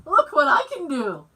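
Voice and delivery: loud, excited show-off; proud boast. Retold or imitated. Bragging to her husband, loud and excited. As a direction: brag like a proud kid. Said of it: "I said".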